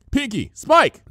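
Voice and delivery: loud, high barked names; barked order. A narrator's reading. Twilight barking for her henchmen, loud and high. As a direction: bark the names like commands. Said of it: "Twilight barked".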